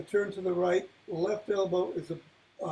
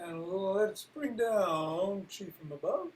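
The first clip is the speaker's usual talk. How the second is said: guided meditation; 5 dB quieter than usual. Acted. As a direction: guide the breathing gently and quietly. Leads a breathing meditation.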